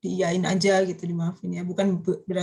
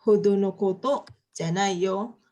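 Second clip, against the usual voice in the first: slow model reading; 2 semitones lower, slower than usual. Shown as a demonstration. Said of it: "ini juga agak panjang ya".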